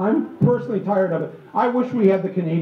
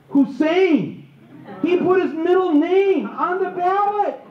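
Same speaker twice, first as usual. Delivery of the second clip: high-pitched incredulous outcry; hammed-up disbelief, pitched high; mock alarm; 9 semitones higher, slower than usual. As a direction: cry it out slowly in exaggerated dismay. Acting out alarm at middle name.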